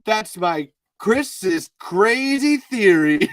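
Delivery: hammed-up impression of an excited friend; Chris. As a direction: build to a drawn-out, booming big reveal. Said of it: "I'm gonna try to do Chris now".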